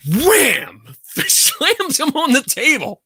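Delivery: high, drawn-out comic exclamation; high-pitched, amused storytelling climax; dramatic slam re-enactment. Retold or imitated. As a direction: punch out the slam, laughing. Acting out her angry slam.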